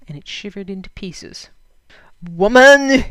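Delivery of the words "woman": full-throated roar. Acted. Peter roars at his wife over Lena, loud. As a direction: bellow at your wife. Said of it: "he roared at his wife".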